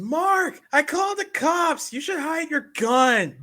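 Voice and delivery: high, slow falsetto call; drawn-out high-pitched imitation; mock panicked warning. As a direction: call it out in exaggerated alarm. Correcting the quoted panicked warning.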